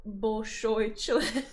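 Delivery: slow, deliberate Russian "Bolshoi член"; careful Russian pronunciation. Shown as a demonstration. Reading the nickname aloud in Russian, slow and careful. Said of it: "read it with a better pronunciation".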